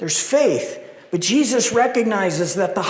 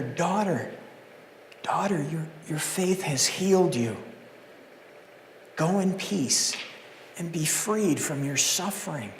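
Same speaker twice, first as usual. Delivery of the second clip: gentle Jesus; 8 dB quieter than usual. Acted. Jesus voiced gently in sermon.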